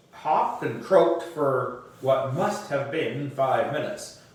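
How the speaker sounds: usual speaking voice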